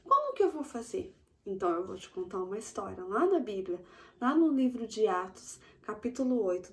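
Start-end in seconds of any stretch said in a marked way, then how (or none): 0.0-1.1 put-on high, small child asking. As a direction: play a little kid asking shyly. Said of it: "você pergunta". (voz aguda de criança pequena)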